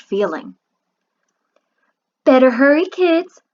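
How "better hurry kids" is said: put-on calling loudly to the kids; mother calling the kids to hurry. A narrator's reading. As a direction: call out from the next room. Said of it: "Mama Green called from the kitchen".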